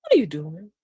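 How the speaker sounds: low, easy question. Shown as a demonstration. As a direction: ask it casually, no accusation. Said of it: "let's twist it around".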